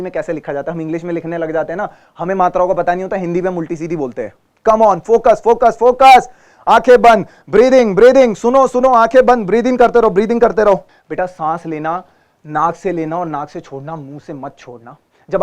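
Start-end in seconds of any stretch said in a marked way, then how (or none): 4.7-6.2 loud, rising coach call; rally the students to focus. Real, not acted. Teacher loudly urging students to focus. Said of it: "coach calling the class". 6.7-10.7 coaching call. Real, not acted. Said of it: "guiding breathing exercise".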